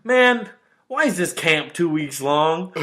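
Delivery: high, loud mock whine of complaint; frustrated kid. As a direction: imitate a fed-up little kid. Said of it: "he just is real frustrated".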